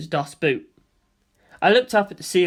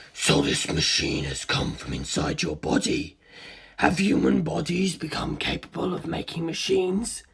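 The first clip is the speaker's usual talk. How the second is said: calm inhuman curiosity; cold ancient being; 5 dB quieter than usual. A narrator's reading. Cars voiced deep and cold.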